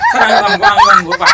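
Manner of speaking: put-on high-pitched, eager child voice; eager schoolboy. Son volunteering to sir to break legs, eager high voice. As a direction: pipe up high like a keen little boy.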